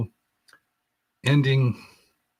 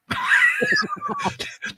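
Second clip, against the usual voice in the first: embarrassed laugh. Real, not acted. I'm such a geek.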